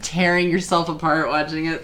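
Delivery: ordinary talk, nothing marked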